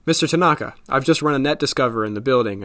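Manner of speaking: loud call across the room; loud report called out to Mister Tanaka. Acted. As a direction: call out to get his attention. Calling out to the boss.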